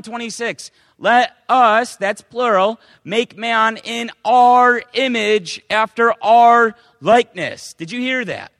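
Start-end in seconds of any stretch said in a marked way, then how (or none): 1.0-2.0 loud, solemn reading of holy words; loud preacher reading scripture. Acted. Reading scripture aloud in sermon. 3.0-7.7 loud, solemn reading of holy words; loud preacher reading scripture. Acted. Reading scripture aloud in sermon.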